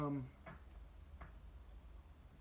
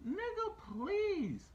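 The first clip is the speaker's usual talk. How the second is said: dismissive scoff; 12 semitones higher, much slower than usual. Real, not acted. Dismissing commenters' excuse.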